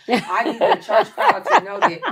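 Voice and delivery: laughing while pleading loudly; loud laughing appeal. Real, not acted. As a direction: push it out through real laughter. Laughing plea to church crowd.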